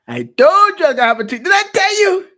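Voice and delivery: high, loud gloating parent; mocking I-told-you. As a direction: crow over them like a gloating parent. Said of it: "I ain't trying to hear that".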